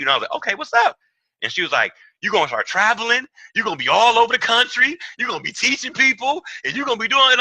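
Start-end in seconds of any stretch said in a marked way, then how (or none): 0.4-1.0 eager high reply. Retold or imitated. 2.2-7.4 mock prophetic woman. Woman prophesying over him.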